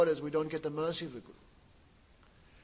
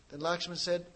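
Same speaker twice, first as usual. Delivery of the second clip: usual speaking voice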